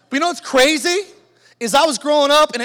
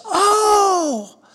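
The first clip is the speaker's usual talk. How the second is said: a high, startled shout of oh; surprised oh; 9 semitones higher than usual. Retold or imitated. Woman meeting a boy whose voice surprised her, startled shout. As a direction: gasp it out high, caught off guard.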